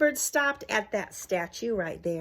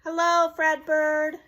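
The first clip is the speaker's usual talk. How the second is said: high and loud friendly hello; cheerful greeting; 8 semitones higher, 9 dB louder, slower than usual. Acted. Best hitter at the plate greets Fredbird, loud cheerful hello. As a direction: greet him like a happy ballplayer. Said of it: "stepped to the plate and said".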